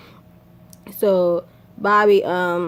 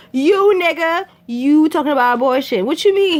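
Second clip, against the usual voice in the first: higher, louder accusing; higher indignant accusation; heated accusation; 8 semitones higher, 5 dB louder than usual. Retold or imitated. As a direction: throw the blame back at him. Arguing over abortion.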